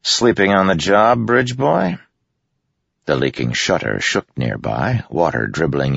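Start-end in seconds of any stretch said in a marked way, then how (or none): 0.0-2.0 smirking tease. A narrator's reading. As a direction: needle him with a smirk. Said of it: "his lips rose in a smirk".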